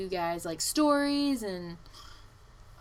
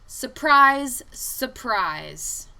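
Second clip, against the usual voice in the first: much louder, mock-cheerful surprise; sarcastic; 3 semitones higher, 9 dB louder, much slower than usual. Real, not acted. Telling viewers the driver was never fired, sarcastic. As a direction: sell the sarcasm with a loud burst.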